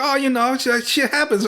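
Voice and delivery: high-pitched, hammed-up movie gangster; Tony. Gangster shrugging off failure to his boss on the phone, hammed-up high voice. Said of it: "I can't do a good Tony face".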